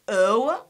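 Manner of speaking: "O" as rising "oh-uh"; triphthong pronunciation. Shown as a demonstration. Teacher listing what we have, "O" as rising "oh-uh". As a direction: glide "O" into schwa with a list rise. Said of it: "we have".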